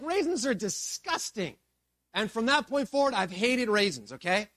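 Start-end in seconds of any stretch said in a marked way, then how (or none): 0.0-1.5 put-on higher-pitched childhood revulsion; acted disgust. First grader after spitting out a raisin, acted disgust.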